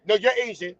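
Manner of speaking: normal talking voice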